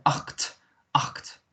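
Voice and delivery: "acht" with throaty ch and a final t; t on the end. Shown as a demonstration. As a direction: finish "acht" on a t after the throat sound. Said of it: "put a t on the end".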